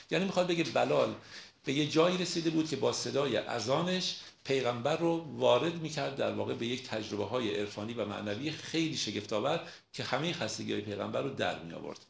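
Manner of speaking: normal talking voice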